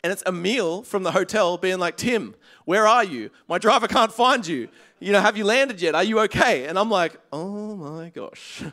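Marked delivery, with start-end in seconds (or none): 2.0-6.7 louder, higher, urgent retold worry; worried hotel manager. 7.3-8.6 slow, drawn-out "oh my gosh"; dawning realisation. Retold or imitated. Realising they were duped.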